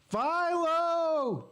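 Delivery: high, drawn-out heckler's yell; drunk fan's yell. Retold or imitated. Drunk fan behind him heckling the team, yelling high and long. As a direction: holler it like an obnoxious fan. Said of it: "He yelled that for fifteen minutes straight".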